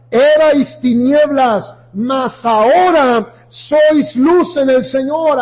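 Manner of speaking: loud, rising sermon voice, slow on the key lines; fiery preaching. Acted. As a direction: preach it hard to the congregation. Builds into full preaching.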